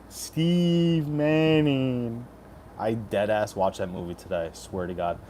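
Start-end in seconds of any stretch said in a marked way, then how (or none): 0.1-2.3 announcer drawl. Acted. Hyped name callout.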